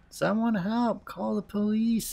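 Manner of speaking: high-pitched call for help; acted yell for help. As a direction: call out high, stretching the last words. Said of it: "I'd yell a bit louder".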